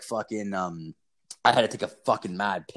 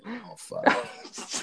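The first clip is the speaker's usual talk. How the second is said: dismayed realization; 13 semitones higher, 5 dB quieter, much slower than usual. Real, not acted. Imagining girlfriend hearing the podcast.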